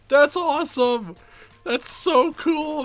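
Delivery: high, drawn-out thrilled exclamation; real excitement. Real, not acted. Gamer thrilled by the menacing guy's new noise, excited.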